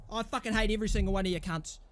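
ordinary talk

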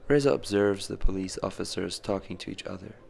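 plain reading voice

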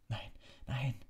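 breathless, whispered denial; gasped. A narrator's reading. Harry gasps in fear as the Dementor's hand reaches out. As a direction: gasp it in fear. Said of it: "keuchte Harry".

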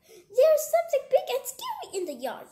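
high, loud squirrel in a panic; panicked gasp. Acted. Squirrel raising the alarm in the coop, panicked gasp. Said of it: "gasped the squirrel".

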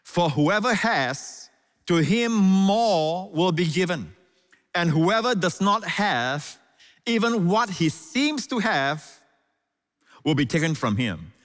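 slow, high, drawn-out reading of the verse; solemn scripture reading. Acted. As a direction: read it slowly and solemnly over the music. Reads scripture slowly over music.

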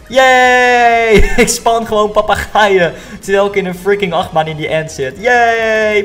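loud, high, giggly cheer; excited squeal. Real, not acted. Spawning parrots on a rollercoaster.

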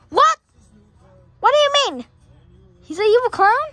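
high, loud incredulous questions; shocked disbelief. Pretend. Friend hearing of the evil clown, loud disbelief. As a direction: react in play-acted shock.

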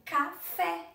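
café with the final "fé" punched loud and high; stress on last syllable. Shown as a demonstration. Teacher showing pupils where café is strongest, stress on last syllable. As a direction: split "café" slightly and hit "fé". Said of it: "a sílaba mais forte está na última".

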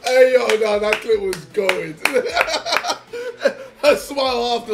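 words squeezed out between loud laughs; can't stop laughing while talking. Real, not acted. Still laughing at the clip.

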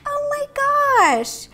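high, drawn-out squeal of affection; touched gushing. Real, not acted. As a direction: melt over something adorable, slowly. Gushing over cute game detail.

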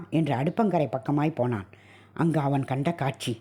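ordinary delivery, nothing marked

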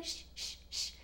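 soft voiceless shushes; very quiet, rhythmic shh; mothers shushing. Acted. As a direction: hush a crying baby in rhythm. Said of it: "Las mamás en el bus dicen".

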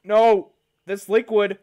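high, loud panicked warnings; alarmed shouting. Real, not acted. Vlogger shouting warnings at the clip, alarmed. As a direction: shout at the screen to stop it.

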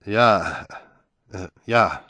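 stammering. A narrator's reading. George caught off guard by his wife, stammering. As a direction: stumble over the words, startled. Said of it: "stotterte George".

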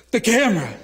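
the penny drops, loud; sudden alarm. Retold or imitated. Tourist realizing the camera is gone, alarmed outburst.

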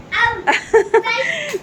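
high amused laugh; bright giggle; laughter. Real, not acted. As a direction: laugh along with the class. Laughter during the game.

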